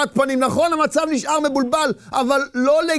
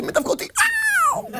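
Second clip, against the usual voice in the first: panicked cry. Acted. Lion comes right at him.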